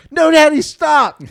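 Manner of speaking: squealed high and loud, a hammed-up girl; mock high loud plea; mock squealing girl. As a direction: shriek it like a girl playing scared. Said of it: "she goes".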